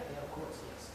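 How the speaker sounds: ordinary talk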